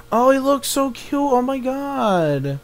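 high, soft gushing; adoring coo. Real, not acted. Player gushing over an adorable mouse, high soft coo. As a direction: coo softly over something tiny.

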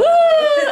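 loud, high-pitched woo; cheering whoop. Real, not acted. Vlogger cheering her singing roommate, loud whoop. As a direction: whoop in applause.

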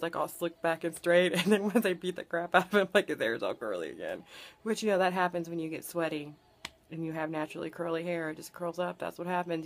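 normal talking voice

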